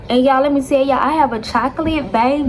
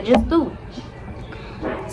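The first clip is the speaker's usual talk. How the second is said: exhausted whispered plea; 7 dB quieter, much slower than usual. Retold or imitated. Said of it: "I'm like".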